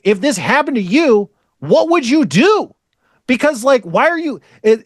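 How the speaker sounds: high-pitched, insistent questioning; exasperated demand. Retold or imitated. Speaker demands an answer from an evasive co-worker, exasperated. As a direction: plead for a straight answer, fed up.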